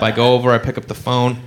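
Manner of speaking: ordinary talk, nothing marked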